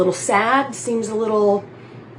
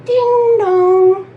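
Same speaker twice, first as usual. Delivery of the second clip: higher, louder sung ding dong; doorbell imitation; 10 semitones higher, 6 dB louder than usual. Reenacting a movie doorbell, sung ding dong. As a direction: chime it out like a doorbell. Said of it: "I will now reenact for you".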